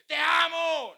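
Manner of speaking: loud, high, yelled "¡Te amo!"; shouted love you. Shown as a demonstration. Pastor shows the wrong way to say te amo: a loud yell. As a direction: bark "¡Te amo!" like an order. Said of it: "¿cómo se dice?".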